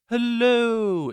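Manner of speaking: high, loud cartoon greeting; very high cartoon-character imitation; Pim. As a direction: call it out long in a squeaky cartoon voice. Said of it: "'cause Pim, he goes".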